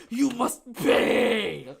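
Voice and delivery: very slow, high, loud you must pay; menacing demand. Retold or imitated. The copyright owners demanding payment, slow and loud. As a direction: drag it out like a villain. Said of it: "They're like".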